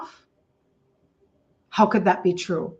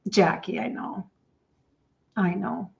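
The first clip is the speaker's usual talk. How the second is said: barely audible, holding back tears; soft, tearful thanks to a friend; choked up; 6 dB quieter than usual. Real, not acted. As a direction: near tears, voice almost gone.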